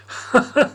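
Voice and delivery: short breathy laugh. Acted. Dionysus amused by Patroclus's begging, a short breathy laugh. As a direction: laugh off the boy's fear. God amused by pleading boy.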